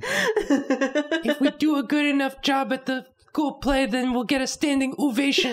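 put-on very high little girl voice, laughing; squeaky child cartoon voice; Anya voice. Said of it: "Anya from Spy Family".

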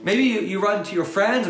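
ordinary talk

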